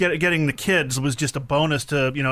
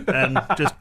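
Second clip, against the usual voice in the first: normal talking voice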